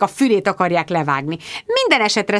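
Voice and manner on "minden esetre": ordinary delivery, nothing marked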